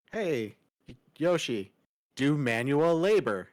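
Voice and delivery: loud mocking command; mock bossy shout. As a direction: bark the order like a boss. Mocking show dialogue.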